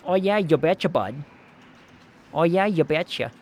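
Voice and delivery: folksy Fargo movie accent. Shown as a demonstration. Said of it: "you got the movie Fargo accent".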